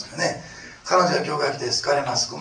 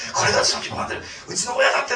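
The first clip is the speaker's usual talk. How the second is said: mock joyful; 12 semitones higher, 6 dB louder, faster than usual. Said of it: "とかって言ってましたよね".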